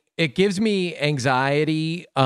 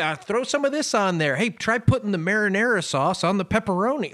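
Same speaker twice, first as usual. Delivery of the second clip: higher-pitched, casual buddy enthusiasm; playful egging on; 6 semitones higher than usual. Retold or imitated. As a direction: toss out ideas to a buddy in a higher voice. Acting out experimenting with sandwich-maker buddy.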